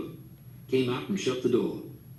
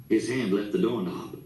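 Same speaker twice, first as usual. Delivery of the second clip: plain reading voice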